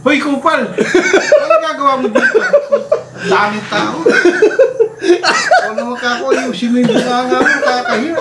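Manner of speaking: high, loud laughing call; retold shout across the market. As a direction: yell it across the crowd, half-laughing. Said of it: "salubungan kami". (sigaw sa palengke habang tumatawa)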